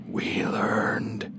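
eerie drawn-out whisper; whispering voices. A narrator's reading. The voices fill the narrator's head, eerie whisper. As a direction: whisper it slowly, all air.

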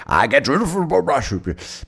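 high, drawn-out silly voice; mock put-on voice. Podcaster joking about his laundry, silly put-on voice. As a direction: stretch it out in a high comic voice.

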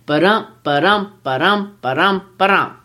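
ba-DUM, stress on each second syllable; iambic beat. Shown as a demonstration. Teacher demonstrates the meter of blank verse, iambic beat on "Ba-dum". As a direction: chant "ba-dum" with a light BA and a heavy DUM. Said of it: "And it sounds like this".

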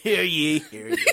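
town crier. Pretend. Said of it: "That was so cursive".

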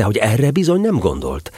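ordinary delivery, nothing marked

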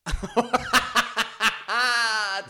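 loud real laugh; laughing hard at a mate's line; burst of laughter. Real, not acted. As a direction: crack up at the joke. Laughing at top five joke.

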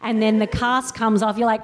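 ordinary delivery

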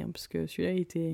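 ordinary delivery

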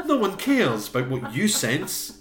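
scornful heckle. Retold or imitated. Co-host heckling Helen's sensing, loud mock-exasperated dismissal. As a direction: shoot her down with playful scorn. Heckling a character's film line.

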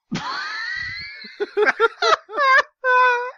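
long, high, loud shrieking laugh; hysterical laugh. Real, not acted. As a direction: explode into uncontrollable high laughter. Helpless laughter at the text.

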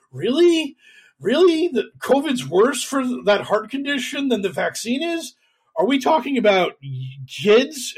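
high, mildly incredulous questioning; Joe Rogan. Retold or imitated. Podcast host doubting his fact checker, in mildly mock disbelief. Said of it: "And Rogan's just like".

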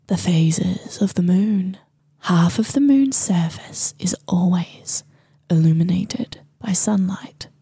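whispery, slightly mystical prophesying voice; witch voice. Pretend. Host reads a moon passage as prophecy, whispery witch voice. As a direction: intone it like a magical goddess, half whispered. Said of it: "read this in my witch voice".

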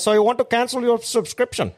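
high, hammed-up Indian call-centre voice; mock Indian accent. Mate imitating the Indian scam caller, high mock accent. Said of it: "this fucking Indian bloke".

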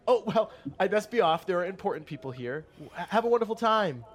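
high, loud, fast and laughing; breezy sarcastic exit. Acted. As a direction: flounce off with fake cheer. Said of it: "she's like".

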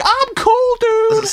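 very high mock granny; hammed-up cool old lady. Old lady calls herself cool, very high. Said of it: "She's like".

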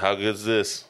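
mic held away from the mouth; distant, off-mic voice; moving handheld mic. Real, not acted. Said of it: "Hold the mic up to your mouth".

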